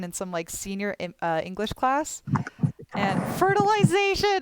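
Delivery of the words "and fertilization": sudden exclamation. Real, not acted. Sudden shout reading chat.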